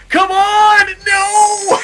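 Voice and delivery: big high shout at the screen, real frustration; frustrated yell. Real, not acted. Gamer shouting at the race as it slips away, real frustrated yell. Race lost by half a second.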